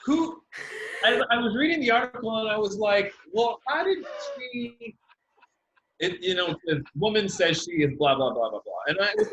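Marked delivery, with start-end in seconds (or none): 3.3-5.0 pitched far up in disbelief; mock-baffled outburst, much higher; incredulous. Reading the article, incredulous high outburst.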